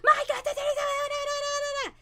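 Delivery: put-on high frantic babble of excited kids; mock crowd clamor. Friends crowding round the player, mock clamor. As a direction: jabber over each other in a high pitch.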